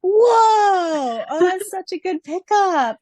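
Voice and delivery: a loud, high burst of real amazement; amazed whoa. Real, not acted. Reacting to her co-host's clever pickup, a loud amazed whoa. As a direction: blurt it out, truly wowed.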